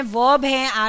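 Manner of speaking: ordinary talk, nothing marked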